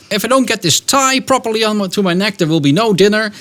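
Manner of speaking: a dramatic husband catastrophizing to his wife; worried husband warning. Retold or imitated. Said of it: "he begins to warn his wife".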